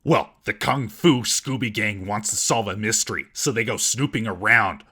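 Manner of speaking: normal talking voice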